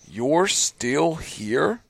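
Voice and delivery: very slow defiant question; a slow taunting challenge; drawn-out taunt. Retold or imitated. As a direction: stretch it out, unbeaten. Quoting a fighter's defiant line.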